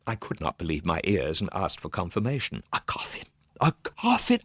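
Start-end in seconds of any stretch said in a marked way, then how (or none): 2.7-3.2 stunned whisper. Acted. Hears of friend's coffin. 3.6-4.4 shocked cry. Acted. Hears of friend's coffin.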